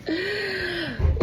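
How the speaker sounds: high breathy giggle; a quick high laugh. Real, not acted. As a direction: laugh lightly to yourself. Laugh after joke.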